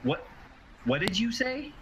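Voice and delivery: sharp high startled question; alarmed. Acted. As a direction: jump up in alarm. NPC alarmed by mention of relic.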